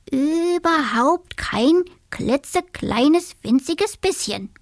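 high, slow, drawn-out boast; exaggerated bravado. A narrator's reading. Dormouse boasts to his friend, drawn-out and high. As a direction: stretch every word to prove you're brave.

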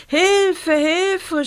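very high, panicked cry; screaming for help. A narrator's reading. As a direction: cry out for rescue in terror. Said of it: "schrie Rosa".

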